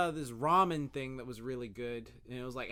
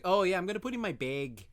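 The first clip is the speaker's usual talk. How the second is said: Minnesotan "bag", slightly raised voice; imitated Minnesota accent; 5 semitones higher, 6 dB louder than usual. Imitating how Minnesotans say "bag", Minnesota accent. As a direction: say "bag" as "beg", Minnesota style. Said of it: "the way they say bag is funny".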